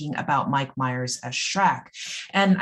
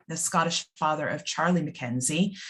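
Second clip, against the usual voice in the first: ordinary talk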